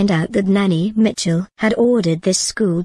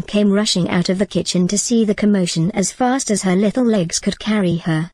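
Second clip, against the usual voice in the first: ordinary delivery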